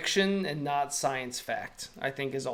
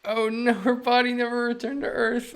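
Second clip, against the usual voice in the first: laughing loudly and much higher; 10 semitones higher, 7 dB louder than usual. Real, not acted. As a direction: burst out laughing through the line. Dark space fact amuses streamer.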